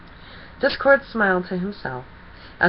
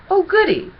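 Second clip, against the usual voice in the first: high, loud delighted squeal; gleeful squeal; 12 semitones higher, 5 dB louder than usual. A narrator's reading. Discord mocks the pony's threat with a gleeful squeal.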